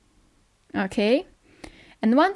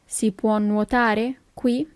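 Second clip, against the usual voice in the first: slow question, words set apart; slow teaching repeat. Shown as a demonstration. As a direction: ask it slowly for learners. Teacher repeats slowly for learners.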